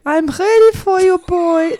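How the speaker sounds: put-on high, loud German-accented woman. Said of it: "say it in a German accent".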